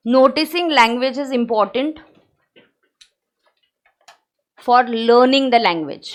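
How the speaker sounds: very slow dictation, phrase by phrase. Acted. Teacher dictating the notes line for students to copy. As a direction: dictate it slowly so they can write.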